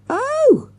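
very high shriek. A narrator's reading. Girl who thinks she is shot, shrieked. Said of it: "shrieked Avril".

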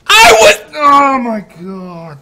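high, loud wail of disbelief; anguished cry. Real, not acted. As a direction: cry out, stretched slowly. Just died, crying out.

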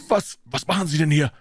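stammered, high-pitched startled question; mildly breathless alarm; breathless shock. A narrator's reading. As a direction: catch someone in the act, voice jumping up. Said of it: "fragte er atemlos".

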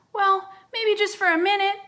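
put-on high, loud child voice; scared little boy. A narrator's reading. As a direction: accept while pretending not to be scared. Scared twin accepting lap.

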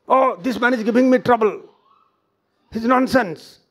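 mildly exasperated outburst, acted; acted complaint. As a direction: complain as if put upon by someone. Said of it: "He speaks like this".

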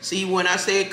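ordinary talk, nothing marked

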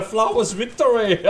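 loud, high, laughing victory shout; relieved laughter. Real, not acted. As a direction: laugh through the words.

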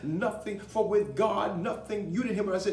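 impassioned rallying of the congregation; full-force preaching. Real, not acted. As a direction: preach it hard, punching each phrase.

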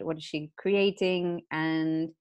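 usual speaking voice

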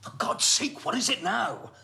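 loud, high-pitched irritated outburst; exasperated. Acted. Answering what seems another unwanted late call, exasperated. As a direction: answer as if fed up with being pestered.

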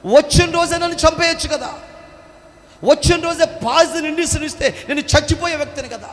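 high, loud, stunned shouting; disbelieving soldier crying out at the nurse; shocked soldier. Acted. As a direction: demand why she spared him. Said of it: "అని అడిగితే".

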